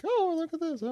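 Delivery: high, thin put-on babble; surface-level chatter. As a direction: burble it lightly in a silly high voice. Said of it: "very surface level".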